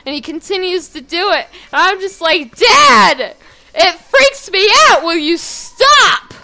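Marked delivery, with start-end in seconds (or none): 2.6-6.3 loud, high, drawn-out whine at a parent; exasperated complaint. Retold or imitated. Daughter begging dad to stop staring, exasperated yell. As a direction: protest loudly, stretching the last words.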